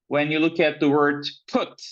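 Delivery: ordinary talk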